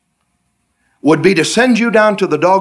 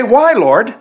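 high pleading "Why, Lord?"; whining why; 7 semitones higher, 3 dB louder than usual. Retold or imitated. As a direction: plead like a stubborn child. Whining believer voiced in story.

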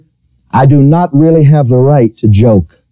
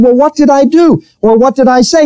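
high, exasperated questions; retelling how he begged to know his offense, high and exasperated; frustrated asking; 11 semitones higher than usual. Retold or imitated. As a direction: press him, baffled and frustrated.

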